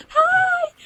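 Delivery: high, loud, drawn-out mock greeting; overly cheery. Imitating cheery US park staff greeting guests, overly happy. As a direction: squeal it like a fake-happy greeter. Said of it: "like, very cheery and stuff".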